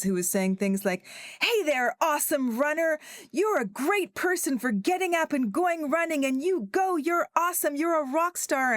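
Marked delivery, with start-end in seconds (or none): 1.4-8.7 high, bubbly mock-cheerleader voice; imitating a chirpy American running app voice; over-cheerful American coach. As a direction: gush like a relentlessly upbeat fitness app. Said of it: "this incredibly cheerful woman".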